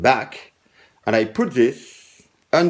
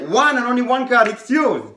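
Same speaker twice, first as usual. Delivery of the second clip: high, proud announcement of the card; showman reveal; 10 semitones higher than usual. Shown as a demonstration. Magician handing the chosen card to the spectator, proud and high. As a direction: present it like a stage magician. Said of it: "And I say".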